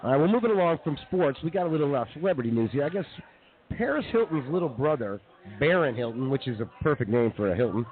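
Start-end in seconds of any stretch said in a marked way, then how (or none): none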